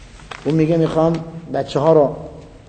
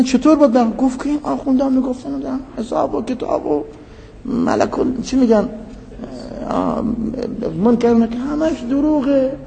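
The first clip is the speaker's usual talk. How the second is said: higher, lightly voiced complaining dead man; mock dead man's voice; 10 semitones higher than usual. Preacher voicing the dead man from a dream, higher mock tone. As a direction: squeak it out like a wronged ghost.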